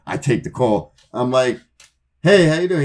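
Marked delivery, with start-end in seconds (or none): 2.2-2.8 forced cheerful greeting. Retold or imitated. Fake-cheerful answering a dreaded call.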